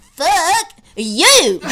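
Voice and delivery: mock yell, high and loud, with a laugh; quoted yell. Quoting Val's best line of the movie to co-host, mock yell. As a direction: shout the famous line half laughing. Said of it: "the best line of the movie".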